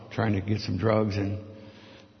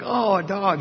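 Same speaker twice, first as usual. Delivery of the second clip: higher-pitched, delighted little exclamation; imitated excited kids; 7 semitones higher than usual. Kids seeing the visitor's dog come in, excited.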